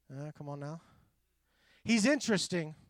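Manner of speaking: ordinary delivery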